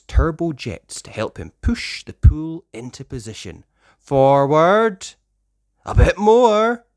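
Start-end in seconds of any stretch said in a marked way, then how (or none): 4.0-6.8 louder guiding commands, slow at stop; directing calls. Acted. Ryder guides Chase with the pool, loud directions. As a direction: guide it into place. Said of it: "directs Ryder".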